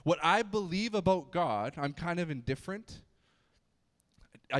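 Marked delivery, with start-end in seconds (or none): none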